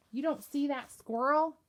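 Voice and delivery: high, loud eager question; excited. Acted. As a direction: point and call out, thrilled. Excited kid pointing at squirrel.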